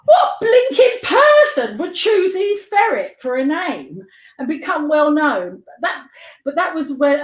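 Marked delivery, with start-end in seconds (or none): 0.1-5.6 high, drawn-out mock disbelief; hammed-up indignant wonder; mock incredulity. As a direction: exclaim it slowly, higher and amused. Mock outrage at own name.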